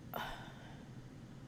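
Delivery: long soft exhale; weary sigh. Real, not acted. Vlogger recalling being stuck without money, weary sigh. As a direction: let out a long, quiet sigh.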